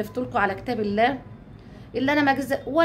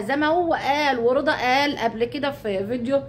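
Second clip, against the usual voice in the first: usual speaking voice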